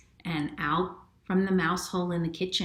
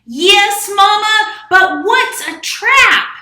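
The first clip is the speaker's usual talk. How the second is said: very high, very loud sing-song; loud mouse; 19 dB louder, slower than usual. Acted. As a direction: belt the question like a squeaky mouse. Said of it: "That loud mouse just could not use a quiet voice".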